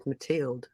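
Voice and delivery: "Mathilde" as "ma-TEELD"; French pronunciation. Shown as a demonstration. Guest telling a French viewer's name, "Mathilde" as "ma-TEELD". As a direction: say "Mathilde" with T, long "ee", silent final e. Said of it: "You're French, so technically it's".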